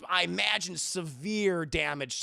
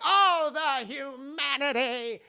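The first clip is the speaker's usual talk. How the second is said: high, loud, slow lament; mock anguished wail; 8 semitones higher, 7 dB louder, slower than usual. Streamer mocking the Hindenburg disaster, drawn-out wail. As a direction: melodramatic cry of disaster.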